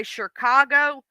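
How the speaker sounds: Chicago as SHUR-kah-go, an R in the first syllable. Retold or imitated. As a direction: slip an R into Chicago like grandma. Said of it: "She'd say it with an R".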